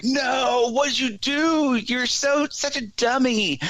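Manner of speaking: mock panic at the guy who lost her phone; imitating a woman freaking out at the man who kicked her phone, pitched very high. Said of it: "really freaking out".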